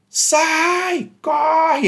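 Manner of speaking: high-pitched, drawn-out mock yell; acted warning shout. Worker up high warns the one on the ground, acted shout. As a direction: call out a long warning from up high. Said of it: "aí ele grita".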